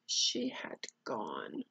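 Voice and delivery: plain reading voice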